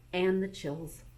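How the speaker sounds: ordinary delivery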